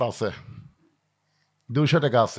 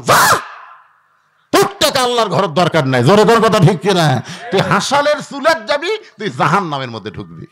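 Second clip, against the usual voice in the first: angry dismissal; 6 dB louder than usual. Acted. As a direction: drive the unworthy out with a shout. Banishing the stingy from mosque. (রাগী গলায় ধমক)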